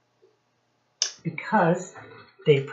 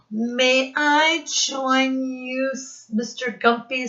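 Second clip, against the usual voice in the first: goat asking; 7 semitones higher than usual. Acted. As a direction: ask politely as a goat. Goat asks in read story.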